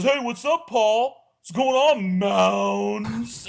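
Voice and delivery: high, loud mocking hello; teasing buddy greeting. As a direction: call out the nickname to needle him. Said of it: "And he goes".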